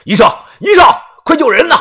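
high, loud desperate yell; loud shout. A narrator's reading. Yu bursting into the hospital, shouting high and loud for a doctor. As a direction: yell for a doctor to save her. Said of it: "大声地喊着".

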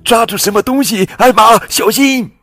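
high, loud alarmed yell; shouting. Acted. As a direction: yell a warning into the storm. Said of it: "韦伯叫道". (高声惊叫)

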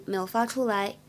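ordinary delivery

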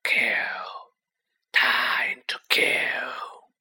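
unvoiced, menacing whisper; cold murderous whisper. A narrator's reading. Unseen monster whispers its hunger to kill. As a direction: hiss each word like a hidden killer.

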